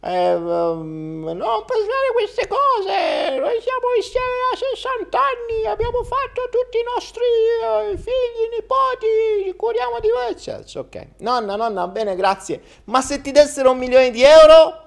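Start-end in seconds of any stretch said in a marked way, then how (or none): 0.0-10.3 put-on old grandma voice. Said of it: "Così forse viene bene". 11.2-14.8 put-on childish boy voice. Boy asks grandma.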